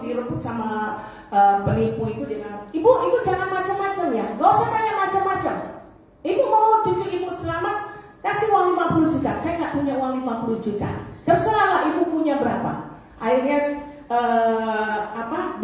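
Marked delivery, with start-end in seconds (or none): 2.7-13.0 higher, fast, pushy threat; mock threat. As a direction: rush her with menace. Scammer threatens the grandmother.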